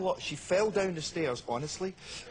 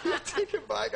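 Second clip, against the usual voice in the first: laughing; 10 semitones higher than usual. Retold or imitated. Giving money back, laughing.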